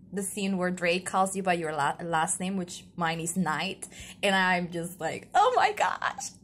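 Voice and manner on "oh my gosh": very high, loud fangirl squeal. Retold or imitated. Gushing to viewers over a favorite game scene, fangirling. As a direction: squeal it with drawn-out, giddy delight. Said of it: "I'm fangirling".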